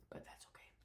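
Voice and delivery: a tiny whispered shrug. Real, not acted. Shrugging off her nausea to viewers, whispered. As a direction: whisper it to yourself, letting it go.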